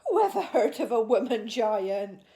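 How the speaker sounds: loud, laughing giant scoffing; a giant tickled by a silly idea. A narrator's reading. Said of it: "laughed the B F G".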